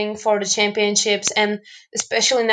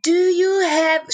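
higher and slower, spelled out word by word for a foreigner; slow overarticulated question; 8 semitones higher than usual. Retold or imitated. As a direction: drag out "Do you have" as if to someone who knows no English. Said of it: "talking like this".

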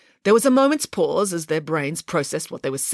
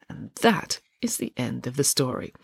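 ordinary delivery, nothing marked

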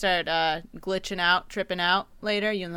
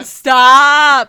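loud, higher stop; loud laughing stop; 4 semitones higher, 18 dB louder than usual. Real, not acted. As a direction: yell stop through a laugh. Laughing protest at being clipped.

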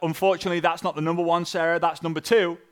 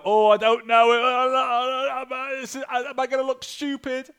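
higher, drawn-out anxious whine; mock doubter; 8 semitones higher, 4 dB louder, much slower than usual. Imitating a doubting recruit's inner voice, slow mock worry. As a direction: voice the nervous beginner. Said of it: "Mindset will make you go".

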